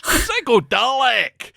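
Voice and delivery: put-on slow, high Scottish accent. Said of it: "is brilliant in a Scottish accent".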